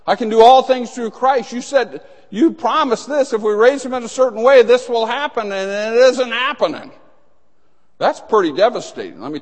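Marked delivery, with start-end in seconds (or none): 0.0-1.5 declared loud and high; ringing preacher's proclamation; bold declaration. Acted. 1.5-6.9 high, loud, fast pleading; a desperate dad crying out; a father pushed past his limit; anguished father's complaint. Acted. Father's despairing complaint voiced.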